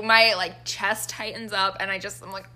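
ordinary delivery

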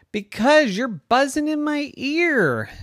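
high cat whine. Acted. Cat Purdy answering the bee, high whiny complaint. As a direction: complain like a grumpy kitty. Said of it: "And Purdy said".

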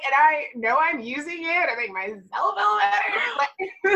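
high, wailing imitation of a tearful confession; exaggerated sobby retelling; mock crying. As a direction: blubber it out, hammed up for laughs. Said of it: "But I remember crying".

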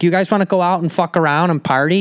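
mocking Chicago accent; dumb-sounding Chicago accent. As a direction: exaggerate a dopey Chicago party girl. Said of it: "it just sounds so dumb".

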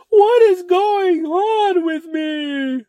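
high, slow crying wail; hammed-up breakdown. Mock existential crisis, high slow crying wail. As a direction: wail it like a horror heroine. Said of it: "you're asking".

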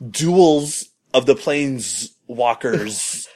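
slow, savoured title with each word stretched out; drawn-out mock title. Shown as a demonstration. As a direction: spell the silly name out slowly. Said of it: "the name of it's just so ridiculous".

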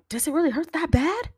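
high-pitched worried question; nervous question. Retold or imitated. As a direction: ask it anxiously, voice jumping up. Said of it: "and I was like".

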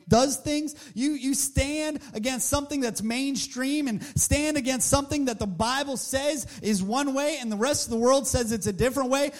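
normal talking voice

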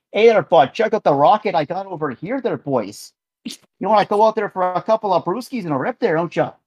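hammed-up Canadian hoser accent; Northern Ontario accent. Podcaster imagines JJ as Ontario bro, hoser accent. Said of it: "he's from, like, Northern Ontario".

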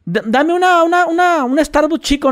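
a lightly voiced wife asking sweetly; light imitation of a wife asking for a small coffee; mock wife voice.

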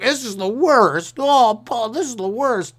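high, slow, drawn-out wail; hammed-up moan of misery; mock whining. Comedian mocking a streetcar rider's complaint, overdone whining.

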